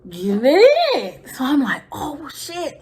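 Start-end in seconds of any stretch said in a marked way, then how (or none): 1.9-2.8 hushed whisper of alarm; panicked whisper at the window. Retold or imitated. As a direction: breathe it out so nobody hears. Peeking at brother through window.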